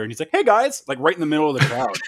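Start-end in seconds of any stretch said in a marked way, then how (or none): 0.3-0.8 high, loud, sudden greeting; mock cheery surprise greeting; cheery hello. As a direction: pop in with a big surprise hello. Imitating Makeshift popping up.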